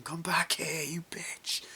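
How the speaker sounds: high, strained mock yell; imitated furious yell. Film heroine shouts at someone getting away, high angry yell. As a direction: call after someone in high-pitched rage. Acting out character's furious chase.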